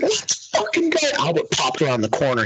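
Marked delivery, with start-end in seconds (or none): 0.0-1.0 high celebratory yell; retold cheer. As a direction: cheer the third squat. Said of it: "I was like".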